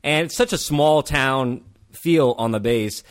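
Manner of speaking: ordinary talk, nothing marked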